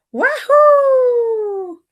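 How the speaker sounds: high, loud cheer; gleeful whoop. Acted. Samson racing downhill, whooping with joy. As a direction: whoop as you fly downhill.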